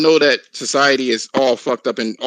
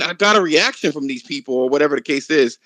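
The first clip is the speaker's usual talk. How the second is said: ordinary talk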